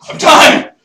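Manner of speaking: high, loud panicked outburst; desperate cry. Retold or imitated. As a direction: scream it as if dying. Acted plea while dying.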